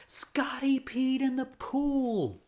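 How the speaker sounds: drawn-out playground yell. Retold or imitated. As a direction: call it out like a kid telling on a friend. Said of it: "And Drew yells".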